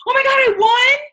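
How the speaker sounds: high, loud squeal of disbelief; hammed-up overjoyed shriek; imitating excited girl. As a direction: mimic a thrilled winner gushing. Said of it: "she was just so excited".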